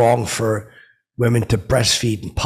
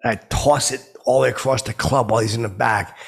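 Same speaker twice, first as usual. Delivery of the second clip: ordinary delivery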